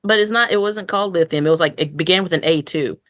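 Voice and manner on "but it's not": ordinary talk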